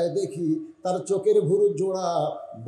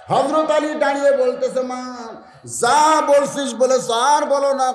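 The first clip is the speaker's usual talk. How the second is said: high, loud sermon delivery; raised, ringing preacher's pitch; full-force preaching; 9 semitones higher, 7 dB louder than usual. Real, not acted. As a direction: preach it out to a big crowd.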